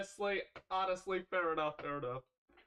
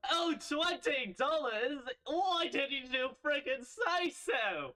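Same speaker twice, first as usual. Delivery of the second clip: excited; 10 dB louder, slower than usual. Acted. Character suddenly delighted by money.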